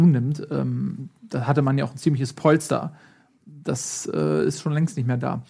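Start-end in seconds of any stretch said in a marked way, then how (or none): none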